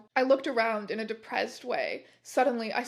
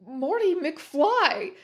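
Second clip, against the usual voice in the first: slow, laughing, louder reveal; laughing surprise; 5 semitones higher, 6 dB louder, much slower than usual. A narrator's reading. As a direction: laugh out the name in disbelief. Surprise appearance in story.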